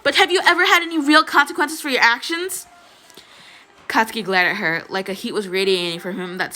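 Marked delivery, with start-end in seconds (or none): 0.0-2.7 high-pitched heated shout; shouted challenge. A narrator's reading. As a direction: fire the question up high in anger. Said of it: "Uraraka shouted".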